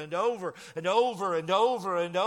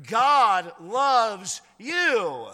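very slow, louder, each word stretched; climactic slow declaration; 4 semitones higher, 7 dB louder, much slower than usual. Real, not acted. Pastor to congregation, slow climactic proclamation. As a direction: let every word land alone.

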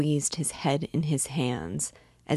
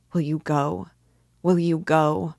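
insistent plea, louder on the repeat; trembling urgency; 5 dB louder than usual. A narrator's reading. Sonia urging him to confess, pleading with mounting urgency. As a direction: press harder the second time. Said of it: "trembling all over".

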